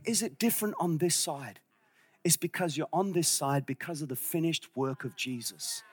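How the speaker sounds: low, soft, near-whispered preaching; quiet and low, slowing at the end; hushed reverence. Real, not acted. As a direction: drop to a hush for the key truth. Hushed build to the key point.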